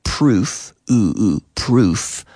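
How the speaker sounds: "proof" with long "oo", sound isolated; double O sound. Shown as a demonstration. Teacher shows double O possibilities, long "oo" in "proof". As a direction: draw out the "oo" in "proof". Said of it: "with this double O sound".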